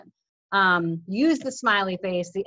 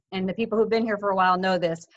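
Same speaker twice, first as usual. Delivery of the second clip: ordinary talk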